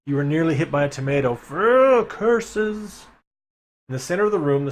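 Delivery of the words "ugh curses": much higher, loud villain groan; mock frustrated outburst; hammed-up curse. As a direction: spit out the curse theatrically. Foiled by tomato.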